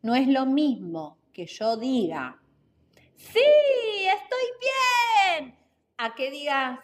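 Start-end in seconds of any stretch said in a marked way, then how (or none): 3.1-5.5 high, drawn-out joyful exclamation; happy, genuinely well. Shown as a demonstration. Podcast teacher demonstrating vocal variety to listeners, happy and sincere. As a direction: stretch each word out high with delight. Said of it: "En una estoy feliz y realmente me siento bien".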